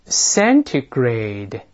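"centigrade" said very slowly, every sound clear, T fully pronounced; slow model reading. Shown as a demonstration. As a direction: stretch out "centigrade" syllable by syllable. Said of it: "我们大声把第一个词先读一遍". (很慢地示范"centigrade"，T清楚发出)

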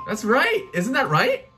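ordinary talk, nothing marked

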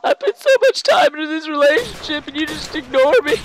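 high-pitched whiny fake sobbing complaint; squeaky mock-hurt wail; mock heartbroken rant. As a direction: play the neglected lover, hammed-up. Mock lovers' quarrel continues.

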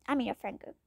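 hushed whisper with a questioning rise. Acted. Kid whispering.

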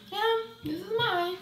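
high, slow little answer; high-pitched mock patience; put-on sweet reply. Acting her reply to the lady.

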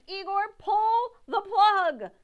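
high, loud, slow command; urgent shout. Acted. Victoria yelling at Igor to pull the plug. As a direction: yell the order in a panic. Panicked order.

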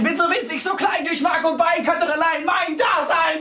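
loud, high, racing shouted verse; loud high frantic poem climax. Acted. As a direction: rant it out in rising frenzy.

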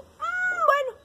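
high, loud parrot voice; advising parrot. A narrator's reading. Parrot telling sheep and hen to swap, emphatic. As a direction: propose it like a bossy storybook parrot. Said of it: "Entonces el lorito le dijo".

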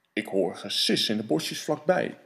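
low, deep monster rumble; Gruffalo voice. Acted. Gruffalo sizing up his dinner, low and deep.

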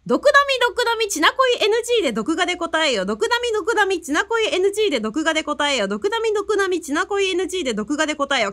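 rapid repeated tongue twister. Shown as a demonstration. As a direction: rattle it off three times. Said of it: "いきます". (早口言葉を三回続けて言う)

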